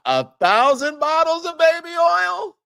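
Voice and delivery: can you believe this, up high; host stunned by the number of bottles; incredulous. Real, not acted. Incredulous at baby oil.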